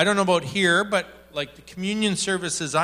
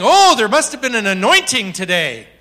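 mock minister; 6 semitones higher, 9 dB louder than usual. Mocking minister's reaction.

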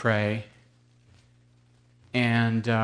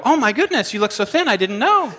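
much higher, louder gushing over-the-top surprise; mock flustered surprise; 11 semitones higher, 3 dB louder than usual. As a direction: gush in a fake-flattering panic. Pretend reply to birthing woman.

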